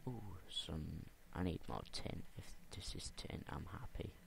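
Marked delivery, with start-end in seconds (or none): 2.4-4.1 unvoiced hopeful whisper to himself. Real, not acted. Whispering to himself while mining for tin. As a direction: breathe it out, barely voiced.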